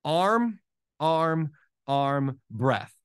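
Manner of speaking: slow stroke count with a gap after each word; simple rhythm. Retold or imitated. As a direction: count swimming strokes, one measured word at a time. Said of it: "a very, very simple rhythm".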